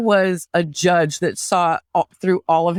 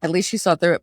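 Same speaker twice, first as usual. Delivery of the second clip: ordinary delivery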